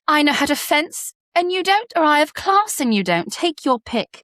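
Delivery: high British film-kid impression; high, put-on British accent. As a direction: play a British schoolgirl movie line, pitched high. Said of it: "It was Parent Trap with both Lindsay Lohan's".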